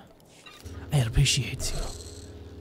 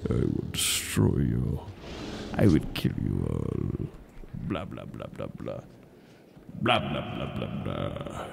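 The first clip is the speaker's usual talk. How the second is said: very deep, slow, quiet threat; menacing deep villain; 10 dB quieter, slower than usual. Pretend. Mocking onlookers with a slow deep villain voice. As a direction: growl softly and slowly like a villain. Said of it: "I could just be like".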